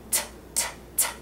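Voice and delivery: crisp whispered T sounds; repeated T. Shown as a demonstration. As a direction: tap out T, T, T. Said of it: "you make the T sound".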